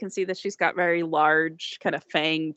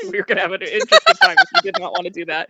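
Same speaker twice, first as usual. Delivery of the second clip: laughing aside; 6 semitones higher, 7 dB louder, much faster than usual. Real, not acted.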